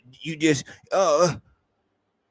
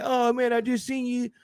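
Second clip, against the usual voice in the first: high, sheepish fan voice; mock timid greeting; timid online fan; 6 semitones higher than usual. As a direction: play the shy fan who only messages. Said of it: "be like".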